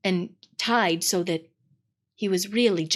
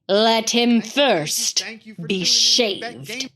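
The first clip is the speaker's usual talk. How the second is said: very slow, cruel order; cold witch; much slower than usual. Acted. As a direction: savour the humiliation as the witch.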